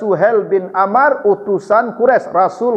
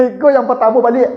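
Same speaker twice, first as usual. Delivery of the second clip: words spoken through a laugh, a bit higher; 7 semitones higher than usual. Real, not acted. Preacher amused telling congregation about Suhel's son, laughing. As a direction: chuckle through the line.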